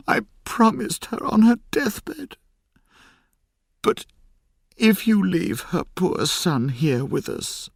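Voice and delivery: tearful plea. A narrator's reading. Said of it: "she cried".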